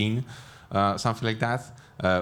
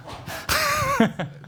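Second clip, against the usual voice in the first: squeaky laugh; nerdy laughing fit; high burst of laughter. Real, not acted. Laughs at hobbies question.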